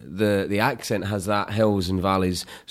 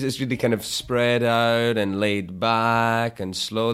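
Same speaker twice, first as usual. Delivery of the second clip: slow, spread-out LA drawl; laid-back Los Angeles accent; 4 semitones higher, slower than usual. Shown as a demonstration. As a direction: stretch it out like relaxed Los Angeles. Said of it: "that's what the accent kind of is".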